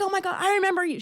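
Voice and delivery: very high, loud burst of recognition; imitated fangirl going off. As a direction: squeal it like an overexcited fan. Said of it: "She's just going off".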